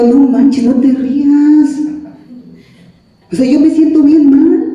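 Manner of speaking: loud, drawn-out mock complaint; upset protest. Protesting at being laughed at.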